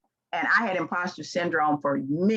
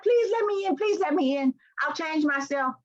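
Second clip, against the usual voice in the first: high, begging plea to be let in; mock pleading; 9 semitones higher than usual. Woman pleads with employers to be let in, high and begging. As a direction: beg like an outsider at the door.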